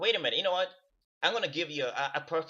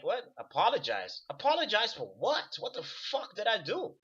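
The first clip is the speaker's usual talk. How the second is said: high, disbelieving questions; incredulous reply; 7 semitones higher than usual. Retold or imitated. Answering the demand to apologize, high and incredulous. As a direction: throw it back in disbelief. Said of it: "it's like".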